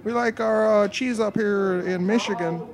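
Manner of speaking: high put-on Upper Midwest accent; Minnesota accent. Shown as a demonstration. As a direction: play a cheese-loving local in a Minnesota accent. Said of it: "a Minnesota accent".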